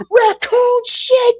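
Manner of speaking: high, slow exaggerated wail; mock outburst. Imitating the host going off about raccoon mess, hammed-up. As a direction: stretch out the words in outrage. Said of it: "went off and said".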